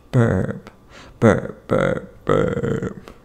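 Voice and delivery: very low, rough croak on each burp; deep croaky burp voice. Pretend. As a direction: croak the words like burps. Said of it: "Say it with me".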